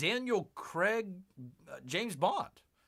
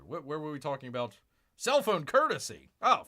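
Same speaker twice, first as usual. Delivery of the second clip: ordinary talk, nothing marked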